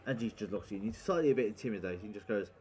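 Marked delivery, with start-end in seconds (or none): none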